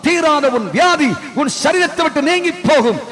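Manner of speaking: each இயேசு வந்தால் hammered like a hammer blow; preaching at full force. Acted. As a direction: build the crowd to a proclaiming climax. Rising altar call.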